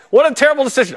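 high, fast announcer call. Retold or imitated. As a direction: call out my life like a broadcaster. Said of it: "I wish I had Mike Tirico".

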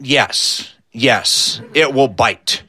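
lightly acted grave answer, low and measured; deadpan confirmation. Skeeter answers Uncle Debo about the skunk, gravely deadpan.

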